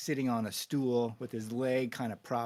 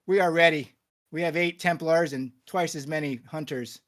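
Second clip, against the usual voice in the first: loud, raised Templar voice; forceful declaration; 4 semitones higher, 6 dB louder than usual. Acted. Templar to the first guard and High Chieftain, forcefully declaring readiness. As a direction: announce it hard, first words loudest.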